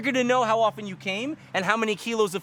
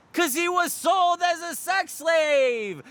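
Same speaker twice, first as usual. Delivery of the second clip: emphatic shout; 10 semitones higher, much slower than usual. Real, not acted. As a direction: hit it hard and slow, up high. Punchline shouted.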